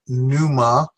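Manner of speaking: "Pneuma" as NOO-mah, no P; silent P. Shown as a demonstration. Teacher choosing own version, "Pneuma" as NOO-mah. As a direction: begin "Pneuma" directly on the n. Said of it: "I'm gonna say what's silent".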